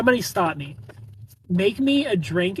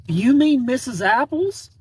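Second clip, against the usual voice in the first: put-on surprised farm boy; 9 semitones higher, 6 dB louder, slower than usual.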